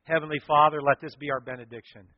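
fervent pastoral prayer over music; closing prayer. Acted. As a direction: pray it reverently, swelling in places.

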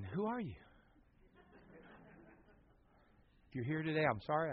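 hushed, confused aside to yourself; baffled aside. Retold or imitated. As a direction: puzzle it over under your voice. His baffled inner reply, retold.

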